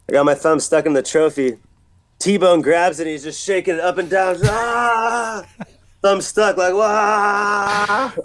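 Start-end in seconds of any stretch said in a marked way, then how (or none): 4.4-5.4 long high "aah" while being shaken; drawn-out cartoonish cry, pitched way up; mock wail. Acting out being shaken with a stuck thumb, mock wail. 6.7-8.1 long, high held "waah"; hammed-up cry on one high note; mock wail. Reliving a thumb stuck in the trophy, mock wail.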